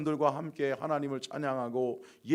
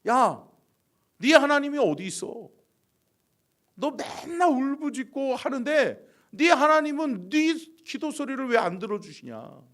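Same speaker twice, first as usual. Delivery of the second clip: raised, loud taunting challenge; mocking; 10 semitones higher, 8 dB louder than usual. Enemies taunting David about his God, mocking. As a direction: jeer at him, pitched up and forceful. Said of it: "조롱하는 거죠".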